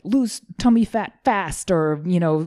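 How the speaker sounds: hint of hyped headline voice; mock magazine headline. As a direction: lightly parody a magazine cover pitch. Mimicking magazine diet headlines.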